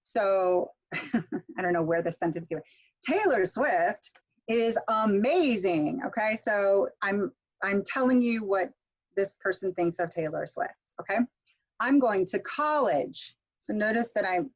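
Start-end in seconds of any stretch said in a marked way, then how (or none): 3.0-6.0 slow, big pitch swoops on "Taylor" and "amazing"; focus-word stress. Shown as a demonstration. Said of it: "get the highest pitch". 11.8-13.3 slow, pitch jumping up on "college"; focus-word stress. Shown as a demonstration. Teacher showing what's really important, high focus stress on "college". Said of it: "I'm focusing on that stress".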